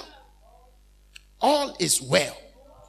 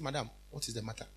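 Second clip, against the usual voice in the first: gentle question; 10 semitones lower, 16 dB quieter, faster than usual. Retold or imitated. Pastor asks crying lady gently.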